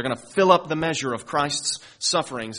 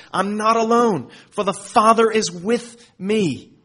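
higher, louder, slow triumphant declaration; triumphant Jesus; 7 semitones higher, 7 dB louder, slower than usual. Acted. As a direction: declare it like a victory, unhurried. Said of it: "look at what he says".

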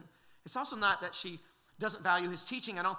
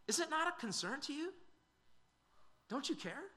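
sharply higher, indignant question; lightly acted accusing sister; perturbed complaint; 10 semitones higher than usual. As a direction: Martha demanding to know if he cares. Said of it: "a bit perturbed with Jesus".

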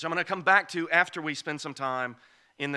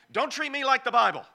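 mock apocrypha author; 8 semitones higher, 5 dB louder, faster than usual. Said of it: "You know what they're saying?".

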